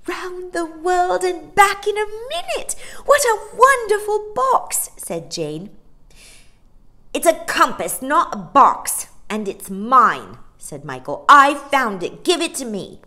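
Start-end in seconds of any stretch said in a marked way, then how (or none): 0.1-4.9 high, loud wonder; thrilled child. A narrator's reading. Amazed child after magic trip. 7.1-10.4 loud, high, hammered words; cross, possessive boy. A narrator's reading. Boy claims the compass crossly. 11.3-13.0 high, loud insistence; cross, demanding boy. A narrator's reading. Boy demands the compass back.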